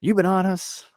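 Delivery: lightly voiced [name], playful; [name] ribbing. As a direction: rib the guy who never stopped working.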